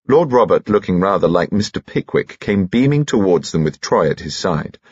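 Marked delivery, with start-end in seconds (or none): none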